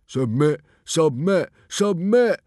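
triumphant, rhythmic shouting; gleeful yell. A narrator's reading. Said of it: "yelled Dog's Breath, gleefully".

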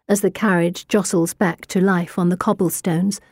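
plain reading voice

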